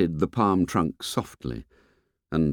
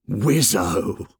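delighted. A narrator's reading. Ralph speaks to himself by the pool, mild delight. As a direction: savour it with contained delight. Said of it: "sounding the bass strings of delight".

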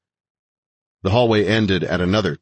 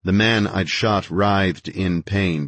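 ordinary delivery

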